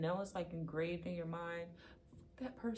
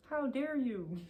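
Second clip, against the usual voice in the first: playful how-dare-you, higher and louder; mock outrage; 6 semitones higher, 5 dB louder, slower than usual. Reader mock-scolding the viewer in playful outrage. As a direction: scold in jest, then laugh it off. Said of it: "I'm just playing".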